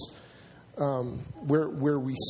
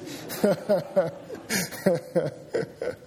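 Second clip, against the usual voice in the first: long, higher-pitched burst of laughter; hearty laugh; 4 semitones higher than usual. Real, not acted. Preacher laughing at his own sweat joke, hearty laugh.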